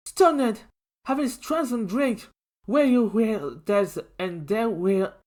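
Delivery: high, startled character voice; a stunned turtle blurting out; stunned figure. A narrator's reading. Lines said by a stunned character, voice high.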